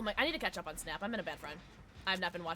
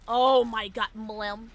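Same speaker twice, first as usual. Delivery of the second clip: usual speaking voice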